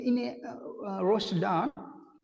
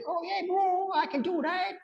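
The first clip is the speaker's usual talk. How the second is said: put-on high Kiwi accent; breezy Kiwi bloke; New Zealand accent. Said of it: "trying a New Zealand accent".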